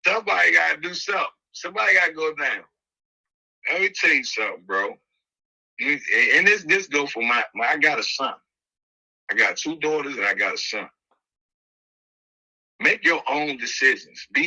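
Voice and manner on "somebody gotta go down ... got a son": usual speaking voice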